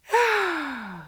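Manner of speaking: a long falling sigh of contentment. A narrator's reading. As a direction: let out a big happy sigh. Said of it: "She sighed".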